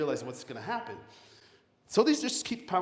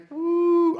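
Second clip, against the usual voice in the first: high loud whoop of joy; excited woo; 9 dB louder than usual. Acted. Speaker whoops at each failure, high and loud. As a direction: whoop like you just got one step closer.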